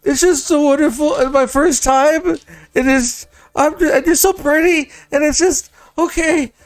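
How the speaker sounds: high, gushing, on the edge of tears; teary joy. Acted. Apprentice gushing to the pretty adventurer, high and teary. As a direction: gush through happy tears. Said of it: "starts to cry a little bit".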